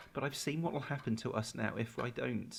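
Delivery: normal talking voice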